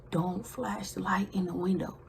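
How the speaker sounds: urgent hushed warning; whispered scolding at the window. Retold or imitated. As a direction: whisper it sharply to her friend. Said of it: "we whispering".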